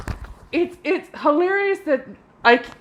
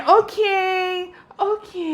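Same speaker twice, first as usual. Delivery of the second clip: higher, stretched-out mock okay; slow talker imitation; 3 semitones higher, slower than usual. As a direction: drag it out like a tedious host. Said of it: "the people who talk like this".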